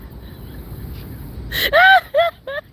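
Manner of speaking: high, sobbing fit of laughter; crying laughter. Real, not acted. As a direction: laugh till you cry.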